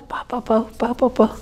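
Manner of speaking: soft, quick "pa pa pa" sound effect; imitated tattooing noise. Tattooist showing work under way, soft imitated noise. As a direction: quietly mimic the tattooing action.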